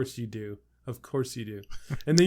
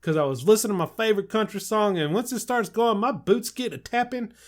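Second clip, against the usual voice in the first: put-on hillbilly accent; 9 semitones higher, 9 dB louder than usual. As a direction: play a rowdy West Virginia hillbilly. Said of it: "like, hillbilly accent?".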